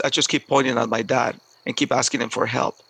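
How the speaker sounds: ordinary delivery, nothing marked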